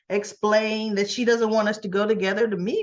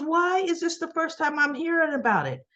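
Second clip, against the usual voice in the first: higher-pitched acted motherly indignation; indignant questioning; 7 semitones higher than usual. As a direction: demand an explanation, imitating a put-out mother. Mother questioning daughter's sudden trip.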